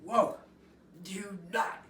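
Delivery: loud, high astonished gasp; amazed whoa. Retold or imitated. Giuseppe reacts to the big meeting, loud and astonished. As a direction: blow him away with surprise.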